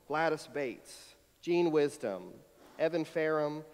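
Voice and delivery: normal talking voice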